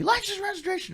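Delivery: high-pitched imitation of a yelling officer; strained, shrieked demand at ordinary volume; screaming cop. Cop repeating his demand to the driver, mock screaming. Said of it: "he keeps screaming at me".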